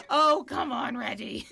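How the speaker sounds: loud, high, through laughter; real laughing protest. Real, not acted. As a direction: protest while cracking up. Cracking up at co-host's remark.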